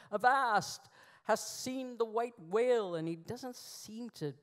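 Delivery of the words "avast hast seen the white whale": hammed-up British Old English, loud and high; British accent. Ahab storms out of the hold, melodramatic British accent. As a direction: thunder it like an old British stage actor. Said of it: "speaking Old English with a British accent".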